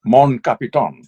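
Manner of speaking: lightly voiced low officer in French; Spock. Shown as a demonstration. Showing the host the dubbed officer, lightly imitated in French. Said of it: "give me a little Spock".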